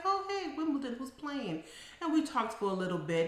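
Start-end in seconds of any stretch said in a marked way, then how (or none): 0.1-1.6 re-enacted high, friendly hello; chatty greeting. Therapist greeting a client watching tennis, chatty high voice. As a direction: greet them in a lifted, casual voice.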